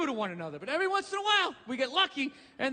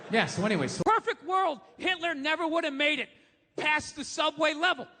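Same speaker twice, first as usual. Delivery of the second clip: ordinary talk, nothing marked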